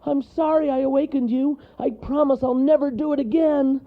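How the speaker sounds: high, loud, frantic apology; squealing scared little character; panicked shout. A narrator's reading. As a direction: cry out pleading for mercy. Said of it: "Wharton shouted".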